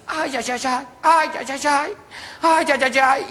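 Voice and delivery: lightly voiced pained ay ay; moaning in pain. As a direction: whimper each step. Beaten friend walks moaning.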